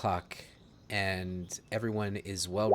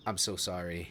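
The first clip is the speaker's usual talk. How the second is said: low, soft apology; subdued and sorry, in character; apologetic; 4 semitones lower, 7 dB quieter than usual. Acted. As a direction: murmur a quiet, contrite apology. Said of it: "he's just apologizing".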